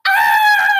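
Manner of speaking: very high, loud imitated baby shriek; mock baby yelp; wordless baby squeal. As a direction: squeal like a baby who can't talk. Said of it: "Cause I didn't know how to talk".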